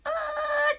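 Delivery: high, sharp cry of the crash; startled yelp. Acted. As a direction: yelp as she hits the ground. Witch crash landing.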